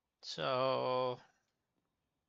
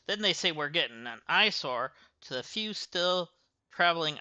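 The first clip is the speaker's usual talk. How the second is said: put-on Southern accent. Gas station owner grumbling about the city, Southern accent. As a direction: read it like a small-town Southern local. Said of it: "got a southern accent all of a sudden".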